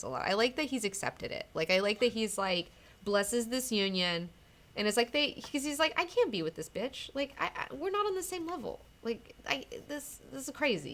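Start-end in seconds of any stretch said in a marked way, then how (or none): 6.0-10.9 higher-pitched, halting mock exasperation; exasperated realisation. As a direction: stammer out a lightly acted protest. Acting out a character's realisation.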